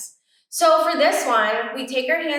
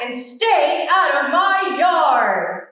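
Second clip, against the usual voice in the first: sing-song grumpy yell; slower than usual. Acted. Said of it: "Hank yelled back".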